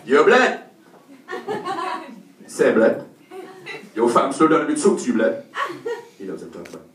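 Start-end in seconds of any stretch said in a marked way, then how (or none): 0.1-5.4 loud mock street-youth slang voice. Youths approaching him as he walks home, mock street-youth voice. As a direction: call out like a cocky youth. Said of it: "these youths start coming at me".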